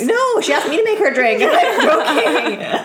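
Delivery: high, laughing joke. Real, not acted. Joking with a laugh.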